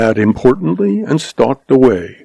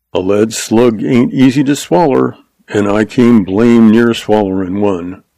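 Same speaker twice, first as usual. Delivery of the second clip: plain reading voice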